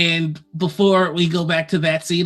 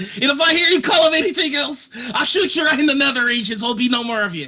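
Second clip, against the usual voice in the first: Lux yelling, laughing; 9 semitones higher, faster than usual. Acted. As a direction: shout the threat while cracking up. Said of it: "Lux loves yelling and threatening people".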